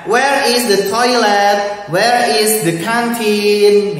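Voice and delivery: slow, clear question for pupils; slow modelled question. Shown as a demonstration. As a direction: spell it out slowly. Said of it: "teacher models questions slowly".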